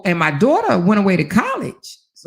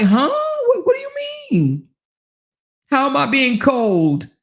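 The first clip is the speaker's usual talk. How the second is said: baffled husband; 7 semitones higher than usual. Retold or imitated. Husband confused, quarrel.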